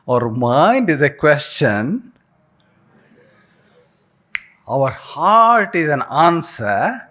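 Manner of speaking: slow, drawn out, each phrase savored with long pauses; slow savoring recital. Shown as a demonstration. Speaker teaching the class to recite the quote, slow and savoring. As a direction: let the words sink in slowly, enjoy them. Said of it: "Say like that, enjoy".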